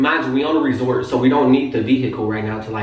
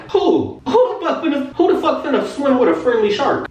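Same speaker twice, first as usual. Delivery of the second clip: high-pitched, laughing disbelief; incredulous protest; 10 semitones higher than usual. Retold or imitated. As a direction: object in a comic squeal of disbelief. Retold refusal to swim with dolphins.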